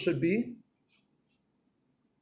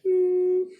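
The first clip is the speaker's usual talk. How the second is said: startled yelp; 5 dB louder than usual. Retold or imitated. Startled medic's noise.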